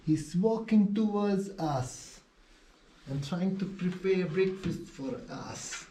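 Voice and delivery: slow, high put-on Spanish accent. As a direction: narrate it in a mock Spanish accent. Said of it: "What accent is this?".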